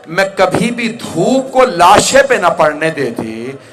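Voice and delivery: loud, high, impassioned imitated grief; tearful lament. Widow grieving over the unshaded body, loud high lament. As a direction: cry it out with full preaching force. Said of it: "जनाब रबाब ने रो के फरमाया".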